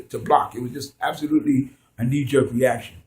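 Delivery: ordinary talk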